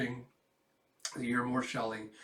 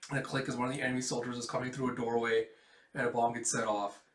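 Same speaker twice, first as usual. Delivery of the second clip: ordinary talk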